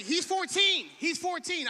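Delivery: loud, high announcement to the room. Real, not acted. Preacher announcing the teen's age to the crowd, loud. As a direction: call it out to the back.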